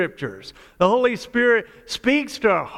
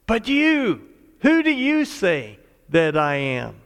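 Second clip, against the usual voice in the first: "you" raised and held apart from the rest; single out "you" with a pitch lift; stress on YOU. Shown as a demonstration. Jesus pressing the disciples, "you" stressed. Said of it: "the emphasis is on you".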